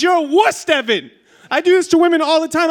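high, loud exasperated wife; mock scolding. Wife waxing her husband's back tells him off, scolding. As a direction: scold him loudly, pitch pushed up.

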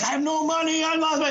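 very high, squeaky mock desperation; desperate pleading. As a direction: squeak it out helplessly, nearly in falsetto. Stranded drunk pleads broke.

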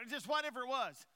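usual speaking voice

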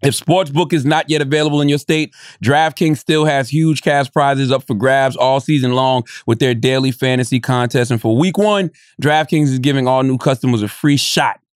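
casual sponsor ad read. Acted. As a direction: pitch the offer to listeners. Reads a sponsor ad.

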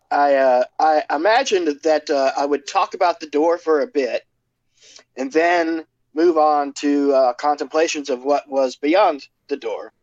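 ordinary talk

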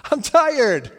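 loud, high, comic complaint; exasperated yell. Acted. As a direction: shout it as if fed up. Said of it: "yelled at the waves".

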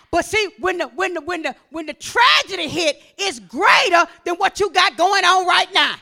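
loud, insistent sermon voice; forceful preaching. Real, not acted. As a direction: press the warning, building to a shout.

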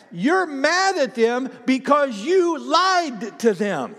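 high, incredulous exclamation; emphatic exclaiming. Acted. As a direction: drive the point home high and drawn out. Exclaiming at the woman, laughing retort.

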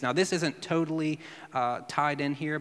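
normal talking voice

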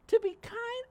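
ordinary delivery

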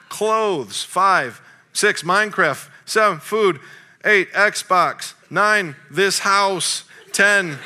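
high, loud, amused list reading; milk each item for laughs; a preacher reading his son's gratitude list to the congregation. Acted.

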